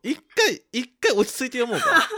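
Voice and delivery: laughing. Real, not acted. Laughing while telling calm.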